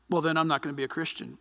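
low, soft, quick grumble; mock sulky objector. Preacher mimicking someone refusing his teaching, mock sulky. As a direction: grumble it quickly under your breath.

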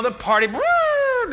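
high, slow trilled brrr; party noise. Retold or imitated. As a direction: roll a party-whistle brrr.

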